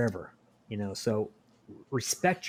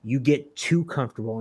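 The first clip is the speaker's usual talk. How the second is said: ordinary talk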